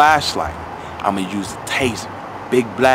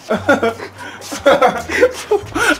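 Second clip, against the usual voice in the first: laughing; 7 semitones higher, 4 dB louder than usual. Real, not acted. Laughing while caught sneaking.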